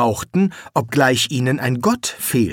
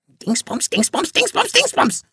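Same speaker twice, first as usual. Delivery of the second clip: Dingsbums climbing ever higher, shrieking; parrot screech. Acted. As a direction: squawk Dingsbums rising to a shriek. (immer höher kreischendes Dingsbums)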